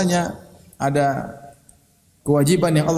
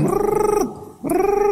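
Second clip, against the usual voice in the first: very high rolled trill; imitated nagging noise. Preacher mimicking a nagging wife, high rattling trill. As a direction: trill "brrr" like endless nagging. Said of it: "Kenapa ngomel?".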